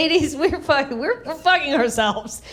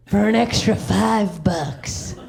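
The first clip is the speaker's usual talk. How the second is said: slow, drawn-out waitress offer; one-toothed waitress; 3 semitones lower, much slower than usual. Acted. One-toothed waitress propositions a trucker, slow drawl. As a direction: play a seedy diner waitress, taking your time. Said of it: "waitress with one tooth pops up".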